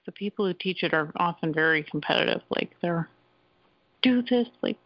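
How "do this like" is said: bossy teacher. Retold or imitated. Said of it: "kinda bossy and stuff".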